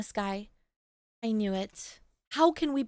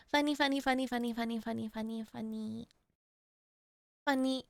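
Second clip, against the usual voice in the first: each funny softer until barely heard; fading repetition; 3 semitones lower, 8 dB quieter, faster than usual. Real, not acted. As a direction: let the repeated word die away. Repeats the word, fading away.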